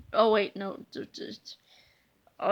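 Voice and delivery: ordinary delivery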